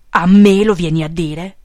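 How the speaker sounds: loud, indignant shouted question; yelled. A narrator's reading. Section boss to his confessing follower, yelled. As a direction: yell it back in outrage. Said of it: "urlò Peppone".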